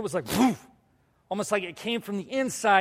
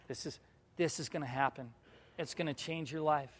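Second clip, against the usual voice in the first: much softer, lower voice; hushed solemn quote; 3 semitones lower, 10 dB quieter than usual. Retold or imitated. Speaker voices the awkward look before foot washing, hushed and solemn. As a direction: drop it to an ominous hush.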